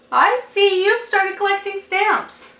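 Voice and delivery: put-on high, slow old aunt; slow high aunt's voice. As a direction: drawl it like a dry old aunt.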